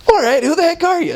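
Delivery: high, fed-up mock demand; exasperated. Woman tired of guessing asks the missionaries: exasperated, high. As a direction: act fed up with all the wrong guesses. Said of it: "she didn't say the word heck".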